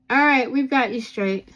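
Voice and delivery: calling out to someone off-camera; loud aside to mom in the room; turning away from phone. Real, not acted. Speaking off-camera to her mom.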